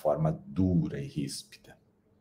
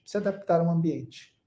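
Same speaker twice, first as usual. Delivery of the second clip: high echo; 11 semitones higher than usual. Shown as a demonstration. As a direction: repeat "Se adaptar ao ambiente" much higher, "adaptar" stressed, falling at the end. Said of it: "e se adaptar ao ambiente".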